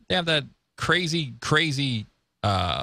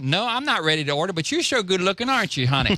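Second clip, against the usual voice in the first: flirty Bubba; 7 semitones higher than usual. Retold or imitated. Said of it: "he goes".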